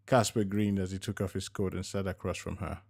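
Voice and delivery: plain reading voice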